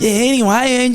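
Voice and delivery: mock your own thickened Australian accent; hammed-up Australian accent. Catching himself after pints, hammed-up Aussie accent. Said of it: "start fucking hamming it up".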